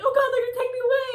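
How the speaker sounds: high, fast acted panic; mock panic. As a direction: blurt it in hammed-up alarm. Child fleeing, fearing being taken.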